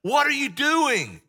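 like a betrayed mentor; Paul hearing the churches are falling, exasperated; exasperated disbelief. Retold or imitated. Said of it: "he's like".

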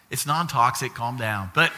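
usual speaking voice

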